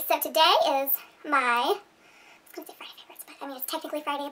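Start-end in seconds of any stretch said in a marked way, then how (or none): 2.5-4.3 hushed, half-whispered self-correction; muttered aside. Real, not acted. Vlogger correcting a flubbed intro to herself, hushed aside. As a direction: mutter it under your breath.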